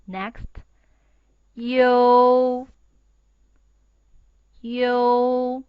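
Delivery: long loud drawn model syllables; slow school dictation. Shown as a demonstration. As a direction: read each out slowly for repeating. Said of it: "please read after me".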